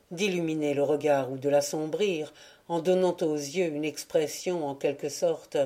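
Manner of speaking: ordinary delivery, nothing marked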